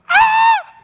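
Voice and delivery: piercing, high-pitched reenacted shriek; sudden full-force scream of fright; loud high scream. Pastor re-enacting his own shock at seeing the fire, screaming. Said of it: "when I screamed".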